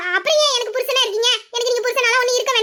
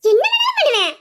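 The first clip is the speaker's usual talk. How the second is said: defiant; 5 semitones higher than usual. Acted. Defiant answer to husband's challenge.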